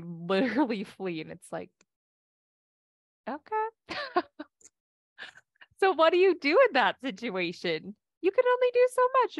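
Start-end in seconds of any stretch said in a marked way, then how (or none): 3.3-3.7 high, drawn-out mock resigned okay; a much higher, bemused okay; exasperated okay. Partner flees the conversation. 3.7-4.4 real high-pitched laugh; laughing at the situation, much higher; high giggle. Real, not acted. Laughing after the quote. 5.8-9.4 much higher and louder, still laughing; fast and giggly, much higher; laughing talk. Real, not acted. Laughing while talking.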